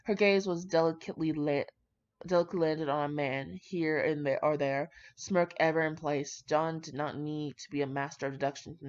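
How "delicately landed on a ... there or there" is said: ordinary delivery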